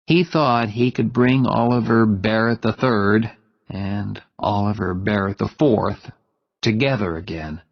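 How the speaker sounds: usual speaking voice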